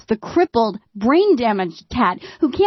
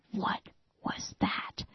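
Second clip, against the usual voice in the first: scared whispered question; hushed whisper so the bear can't hear; 14 dB quieter than usual. Retold or imitated. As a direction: whisper it, afraid of being heard. Todd reacting to a bear outside.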